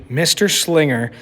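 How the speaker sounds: ordinary delivery, nothing marked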